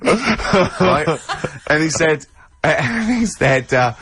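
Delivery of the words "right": laughing. Real, not acted. Ricky laughing at Carl's story.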